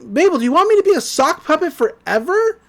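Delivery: high-pitched indignant question; imitated exasperated outburst; mock exasperation. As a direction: demand it in pleading disbelief, lightly acted. Dipper exasperated, retold.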